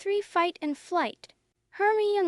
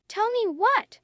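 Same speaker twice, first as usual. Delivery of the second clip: demanding; 6 semitones higher, 5 dB louder than usual. Acted. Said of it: "Umbridge demanded".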